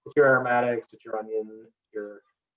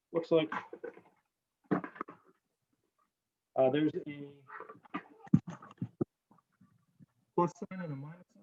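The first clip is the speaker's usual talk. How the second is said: muffled mic; 3 semitones higher, 7 dB quieter than usual. Real, not acted. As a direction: voice muffled by a hand over the mic. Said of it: "Oh, you're covering the mic".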